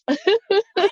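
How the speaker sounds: high little laugh; a quick high laugh at herself; bright laugh. Real, not acted. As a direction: laugh it off lightly. Laugh after joke.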